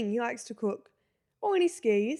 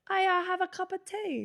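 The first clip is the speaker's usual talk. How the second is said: imitating his posh British accent, a bit higher; mock his British accent, pitched up; 9 semitones higher than usual. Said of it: "his is a bit more".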